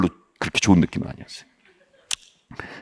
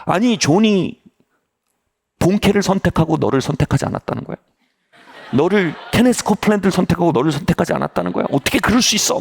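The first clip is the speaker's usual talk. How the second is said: higher-pitched, jokingly indignant protest; playful mock anger; 7 semitones higher than usual. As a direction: act outraged on a friend's behalf, in jest. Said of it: "농담하는 것처럼 갑자기 장난처럼 막 화를 내는". (장난처럼 화내는 척)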